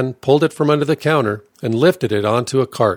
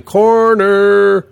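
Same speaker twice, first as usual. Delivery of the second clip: loud, high, drawn-out call; warning yell; 9 semitones higher, 6 dB louder than usual. A narrator's reading. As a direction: yell it out long to warn everyone. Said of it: "after yelling".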